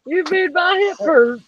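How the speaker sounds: high, playful whine; high teasing voice. Pretend. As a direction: squeak it like a mock complaint. Teasing after joke.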